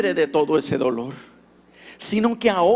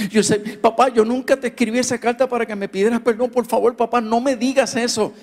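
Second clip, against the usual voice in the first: pleading son; 6 semitones higher, faster than usual. Retold or imitated. Son protesting to father.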